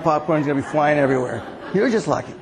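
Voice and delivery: ordinary talk, nothing marked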